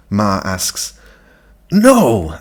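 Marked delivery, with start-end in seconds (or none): 1.7-2.4 put-on hoarse girl's no. A narrator's reading. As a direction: rasp it out. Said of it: "I say hoarsely".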